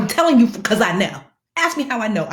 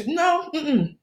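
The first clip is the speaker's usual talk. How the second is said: high, drawn-out no-no; firm refusal; 10 semitones higher than usual. Real, not acted. Refusing to let the bank blow up her phone, sassy no. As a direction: shut it down with sass.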